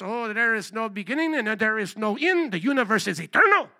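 mock German accent; Albert Einstein. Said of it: "in his German accent".

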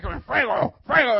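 loud, high punched-out cries; imitated preacher's shout. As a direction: mimic a minister firing off commands. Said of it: "he's just like".

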